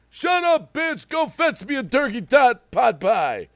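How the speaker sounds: high, loud mock yell at the wife; yelling movie quote. Streamer reciting a movie line to chat, hammed-up yell. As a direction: bark the quote like a bossy movie dad. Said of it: "That's what he says".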